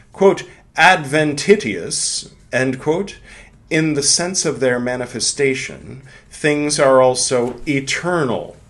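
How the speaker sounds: a measured lecture-reading voice; reading aloud. Acted. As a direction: read it with gravity. Reads the book aloud.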